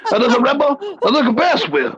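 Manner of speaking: put-on high, blustering old colonel; pompous sputtering military officer. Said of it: "do every top ten in the Colonel voice".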